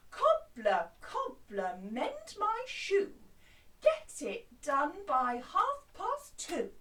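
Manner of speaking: loud, slow, demanding; bossy voice. Shown as a demonstration. Customer ordering the cobbler to hurry, bossy and loud. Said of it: "with a very bossy voice".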